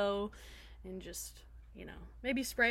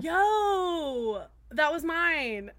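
surprised outburst; 7 semitones higher, 7 dB louder, much slower than usual. Real, not acted. Friend takes her planned topic.

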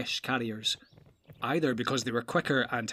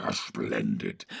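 mocking. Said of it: "laughed Ugluk".

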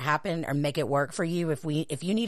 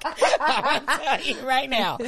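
laughing; 7 semitones higher, much slower than usual. Real, not acted. Laughing mid-bit.

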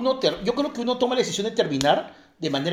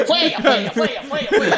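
laughing blame; 5 semitones higher, 4 dB louder, faster than usual. Acted. Blaming the fart on her.

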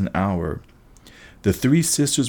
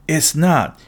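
higher, mildly yelled retort; a touch of squabbling yell; yelled contradiction; 6 semitones higher than usual. A narrator's reading. Anger contradicting the driver in a squabble, mild yell. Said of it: "yelled the one called Anger".

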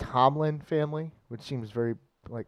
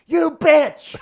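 sudden shrill-high shouted insult, much louder; imagined family yell; 12 dB louder than usual. Pretend. Relative screaming at the contestant, a loud high shout. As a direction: scream it at her like a betrayed relative. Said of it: "someone just to yell".

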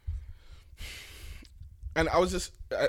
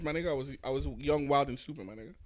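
usual speaking voice

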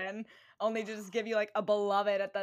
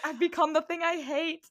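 mock dramatic despair; 7 semitones higher, 6 dB louder than usual. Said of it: "So I'm like".

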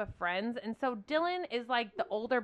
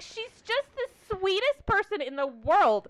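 gushing husband; 10 semitones higher, 4 dB louder, slower than usual. Retold or imitated. Husband praising sweet ladies.

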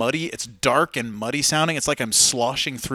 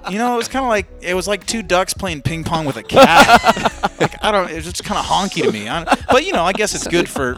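lightly voiced snooty musician, raised; condescending snob; 6 semitones higher, 7 dB louder than usual. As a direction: keep talking down, a bit louder. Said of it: "a little edge of condescending".

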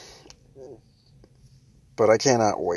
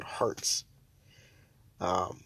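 pained; 5 semitones lower, 10 dB quieter than usual. Real, not acted. Pain after laughing.

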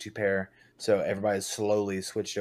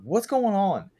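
retold alarm; 12 semitones higher, 7 dB louder, slower than usual. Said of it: "I'm like".

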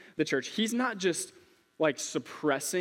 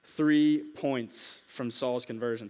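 slow for note takers; 3 semitones lower, much slower than usual. Shown as a demonstration. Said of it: "So if you're a note taker".

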